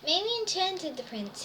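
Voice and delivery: plain reading voice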